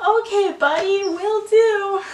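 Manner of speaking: high, loud fake-cheery brush-off; mock agreeable. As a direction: humour him in a put-on high voice. Acted insincere agreement to his request.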